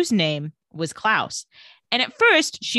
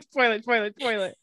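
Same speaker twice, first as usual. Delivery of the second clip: laughing mock alert; 2 semitones higher than usual. Host imitates search results full of spoilers, laughing. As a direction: chant it through leftover laughter.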